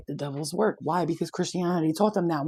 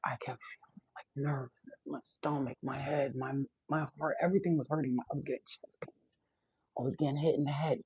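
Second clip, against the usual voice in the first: hushed recollection; 7 dB quieter than usual. Retold or imitated. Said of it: "describing painful feelings while driving".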